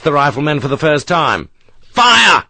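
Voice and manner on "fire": a loud, high one-word order; shout. Acted. Sharp orders the volley, shouting. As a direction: bark it down the line. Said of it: "Sharp shouted".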